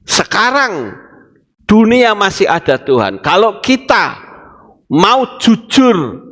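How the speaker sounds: normal talking voice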